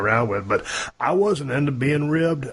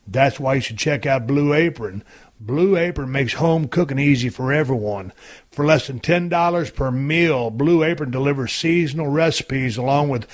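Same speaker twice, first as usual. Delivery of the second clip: upbeat podcast host reading an ad; punchy, rehearsed promotional read; sponsor ad read; 3 semitones higher than usual. Real, not acted. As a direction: sell it to the listeners in a friendly pitch.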